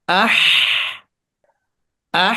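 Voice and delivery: আহ ending in a long forceful exhaled h; short vowel, then a long gust of breath on আহ; pushed breath. Shown as a demonstration. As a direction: press the air out hard at the end of আহ. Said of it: "বাতাসটাকে একটু pressure দিয়ে বের করতে হবে".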